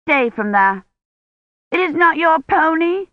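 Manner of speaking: put-on high, indignant child's shout; protesting little girl, pitch climbing. A narrator's reading. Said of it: "Agnes shouted".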